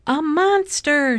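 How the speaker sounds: high, drawn-out cry of alarm; narrator's high-pitched alarmed shout; frightened shout. A narrator's reading. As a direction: shout it as a scared little sister in a story. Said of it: "shouted Baby".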